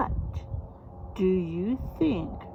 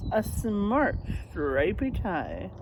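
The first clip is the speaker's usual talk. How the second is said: ordinary delivery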